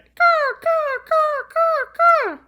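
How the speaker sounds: high, loud crow calls; cawing back. Retold or imitated. Host cawing back at the yard crow, high caws. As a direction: answer the crow in its own call. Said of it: "And I went".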